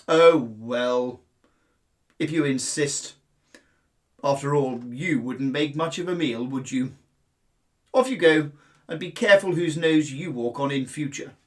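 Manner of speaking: loud, booming lion voice, lofty; lion grandly letting the mouse go; lazy lion. Acted. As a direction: let him off like a sleepy king.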